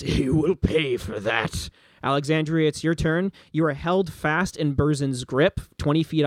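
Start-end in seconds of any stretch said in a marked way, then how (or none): 0.0-1.7 slow, vengeful threat; a slow threat through a bloodied mouth; wounded villain. Acted. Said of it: "A spurt of blood flies out of his mouth".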